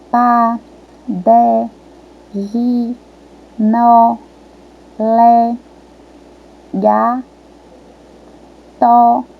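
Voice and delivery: "Pa, de, gi, no, le, ga, to" as isolated, sustained syllables; each of "Pa, de, gi, no, le, ga, to" held evenly on its own; reading syllables. Shown as a demonstration. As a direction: scan "Pa, de, gi, no, le, ga, to" one by one with pauses. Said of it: "as sílabas que formam os nomes".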